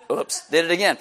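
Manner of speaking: ordinary talk, nothing marked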